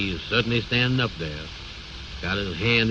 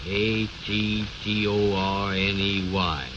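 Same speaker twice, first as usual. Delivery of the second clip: slow spelling out; slower than usual. Acted. Slowly spelling out sign letters.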